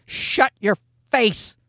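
drawn-out, fed-up scolding; acted parental irritation; mock exasperation. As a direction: tell off a baby in pretend frustration, slowly at first. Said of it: "you hate to say to the kid".

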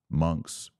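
usual speaking voice

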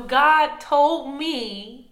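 very slow, drawn-out divine message; mock-solemn revelation. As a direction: imitate a weighty word from God, stretching each word. Retold suitor invokes God, drawn out.

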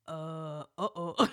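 low, hesitant mock dismay; acted sinking realization, drawn out; uh-oh dread. Couple looking at each other in the back, mock worried. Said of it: "we were like".

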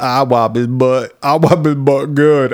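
slow, put-on lover's drawl; hammed-up trashy guest voice, drawn out; talk-show lover. Podcaster plays the lover butting in on Jerry Springer, slow mock drawl.

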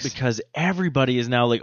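ordinary talk, nothing marked

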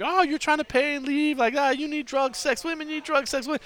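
high, pushy imitation of the bar girl; lightly mock her pushy sales pitch; aggressive hustler girl. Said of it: "she's being super aggressive".

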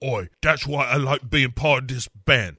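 put-on cockney girl gossip; mock cockney. Spice Girls banter.

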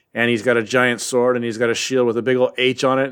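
usual speaking voice

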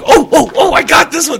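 imitating the wife jumping in, hammed up and shouted; eager blurting. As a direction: burst out like a kid who knows the answer. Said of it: "but it was like".

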